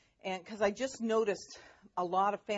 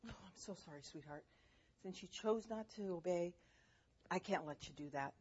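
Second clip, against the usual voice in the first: gentle refusal without yelling; 2 semitones lower, 8 dB quieter than usual. Retold or imitated. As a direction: let them down softly, calmly. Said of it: "Didn't have to yell".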